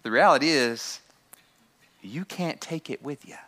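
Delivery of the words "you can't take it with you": hushed statement; quiet. Shown as a demonstration. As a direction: say it softly, almost to yourself. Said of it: "repeated line, first take".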